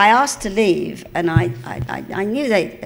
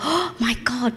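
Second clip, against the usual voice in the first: higher-pitched gasp of disbelief; mock awe; 6 semitones higher, slower than usual. Recalling joining a new BBC show, mock awe. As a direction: gasp it, as if newly overawed. Acting her first reaction to the show.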